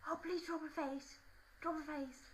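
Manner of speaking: high put-on begging boy; pleading schoolboy. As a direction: mimic a boy imploring for a portrait. Acts Az begging for portrait.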